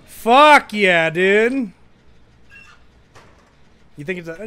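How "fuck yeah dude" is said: high, very loud celebration; triumphant shout. Real, not acted. Player celebrates finding someone, shouting triumphantly.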